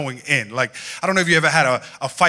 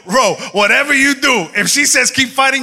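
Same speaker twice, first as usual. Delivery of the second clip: urgent warning; 7 semitones higher, 4 dB louder than usual. Retold or imitated. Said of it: "I'd be like".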